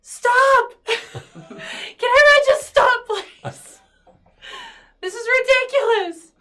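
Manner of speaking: high, very loud exasperated outburst; yelled, high-pitched plea for order; exasperated shouting. Acted. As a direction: shout over the whole room to stop. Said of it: "I'm just gonna be like".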